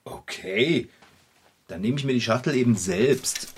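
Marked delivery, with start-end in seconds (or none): none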